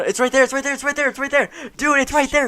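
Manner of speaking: high, frantic urging; panicked pointing it out, high-pitched; panicking gamer. Real, not acted. Countdown, pointing frantically at screen.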